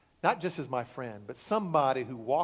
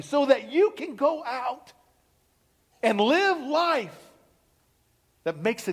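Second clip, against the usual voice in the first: builds higher and louder, slowing to a soaring peak; preaching at full force; 9 semitones higher, 6 dB louder than usual. Acted. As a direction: preach it, climbing to the climax. Pastor builds to sermon climax.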